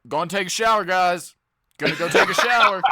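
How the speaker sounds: loud imitation of a vlogger; mock vlog announcement. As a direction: announce it loudly, mocking him. Mocking vlogger's repeated shower announcements.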